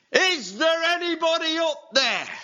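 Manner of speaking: high, loud desperate call upward; calling out to heaven. Acted. Man over the cliff calls up to heaven, desperate shout. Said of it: "he called out to heaven".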